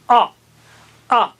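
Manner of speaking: آ said high and thick; teacher modelling the heavy a. Shown as a demonstration. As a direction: raise the tongue and say آ thick. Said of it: "آ اونچا اور موٹا بولیے".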